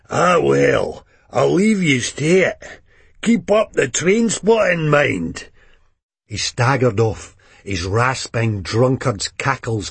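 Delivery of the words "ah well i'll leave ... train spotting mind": put-on high, slurred old wino voice; drunk old man. A narrator's reading. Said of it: "An old drunkard".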